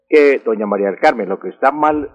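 ordinary delivery

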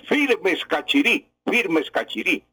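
high ringing call to the troops; rallying battle cry. Retold or imitated. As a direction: proclaim it like a general rallying soldiers. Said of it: "proclama las famosas palabras".

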